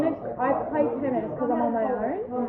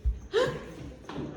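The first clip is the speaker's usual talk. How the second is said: sharp scared gasp; 12 semitones higher, 8 dB quieter than usual. Real, not acted. Spider spotted.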